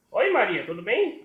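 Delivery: high, loud doll voice; high-pitched puppet hello; little puppet greeting. Acted. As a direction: pipe up as a puppet greeting a friend. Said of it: "Agora ela vai conversar com a Maria".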